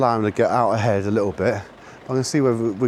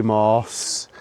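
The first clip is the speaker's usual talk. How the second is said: ordinary talk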